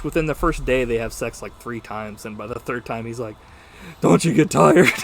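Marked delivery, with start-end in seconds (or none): 4.0-4.9 laughing mock. Imitating weary hero, laughing.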